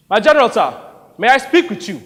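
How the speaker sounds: loud, high soldier's address; Tiger the lieutenant. Acted. Lieutenant asking to speak with his general, loud soldierly address. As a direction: call out formally and loudly to a superior. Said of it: "I assumed the role of Tiger".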